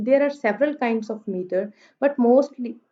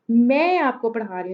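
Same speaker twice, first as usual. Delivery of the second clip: मैं made the most prominent word; stress on मैं. Shown as a demonstration. Teacher repeats the Urdu example, stress on मैं. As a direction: punch मैं, then let the rest follow lightly. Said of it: "मैं stress कर रही हूँ मैं पे".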